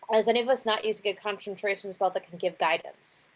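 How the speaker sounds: usual speaking voice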